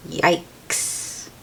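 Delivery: soft unvoiced mock alarm; mock yikes. Reader reacting to the flying hammer. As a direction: breathe a worried yikes.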